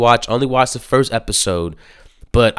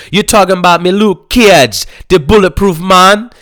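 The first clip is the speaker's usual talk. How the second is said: high, loud Jamaican gangster voice; Jamaican accent; 10 semitones higher, 10 dB louder than usual. Retold or imitated. Jamaican dude asking about Luke Cage, Jamaican accent. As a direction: ask it like a Jamaican dealer. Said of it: "that's how they talk about this man".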